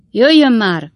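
high, loud summons; shouted call. A narrator's reading. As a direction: call out impatiently down a hallway. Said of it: "kiáltotta Tupman úr a folyosóról".

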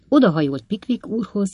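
plain reading voice

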